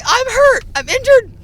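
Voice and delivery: high, loud indignant cry; shrill-free loud high protest; mock hurt shriek. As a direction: wail that you're hurt at the whole hallway. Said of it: "I was like".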